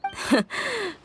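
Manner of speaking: short knowing chuckle; laugh, then turn it down; amused laugh. Acted. Laughs off offer to stay.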